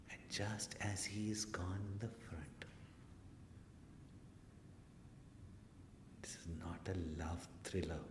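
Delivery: whispered, hushed storyteller; suspense whisper. A narrator's reading. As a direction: pull the room in with a whisper. Building suspense, then joke.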